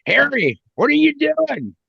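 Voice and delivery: high, drawn-out mock wifely call; put-on high female voice, stretched out; woman's voice calling out. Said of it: "you hear this woman's voice go".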